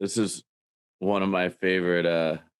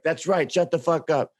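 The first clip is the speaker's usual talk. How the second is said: mock host; 7 semitones higher than usual. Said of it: "He's like".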